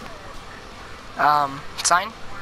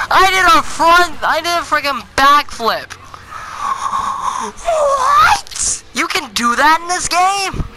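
excited; 8 semitones higher than usual. Real, not acted. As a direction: shout it in disbelief. Landed a backflip.

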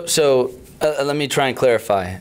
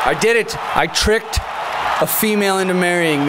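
triumphant shout; 6 semitones higher than usual. Pretend. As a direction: crow it like a villain's win. Boastful mock triumph about wife.

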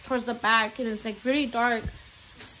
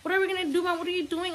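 crying child imitated; 6 semitones higher than usual. Scared son questioning mom in the car, imitated child. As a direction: mimic a frightened child's questions in raised pitch. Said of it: "my baby started crying".

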